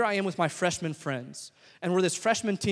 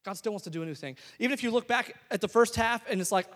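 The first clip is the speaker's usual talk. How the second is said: normal talking voice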